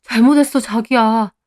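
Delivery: cling and beg for forgiveness; apologetic pleading. A narrator's reading. Husband holds on to his wife, begging forgiveness. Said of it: "저를 붙잡고 잘못했다고 하고요".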